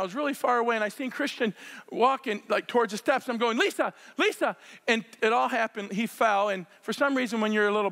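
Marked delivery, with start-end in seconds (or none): 3.6-4.6 calling out across the yard, pitched up. Retold or imitated. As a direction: call for help from far away.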